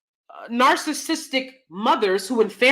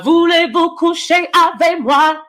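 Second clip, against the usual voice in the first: mock French accent; 7 semitones higher, 4 dB louder than usual. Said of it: "Lexi's from France".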